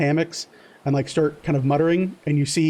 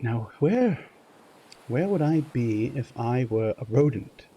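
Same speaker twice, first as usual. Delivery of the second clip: a lightly voiced musing sailor, softer and thoughtful; musing [name]; 3 dB quieter than usual. [name] reasoning about rats to himself, in a lightly voiced musing tone. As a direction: puzzle it out like a rat hunter, lightly voiced.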